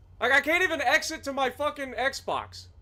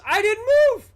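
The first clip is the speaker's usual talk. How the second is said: louder, higher protest that he never moved; insisting it's not his fault, voice jumping up; frustrated outburst; 9 semitones higher, 8 dB louder than usual. Real, not acted. Streamer to chat about a game bug, outraged protest.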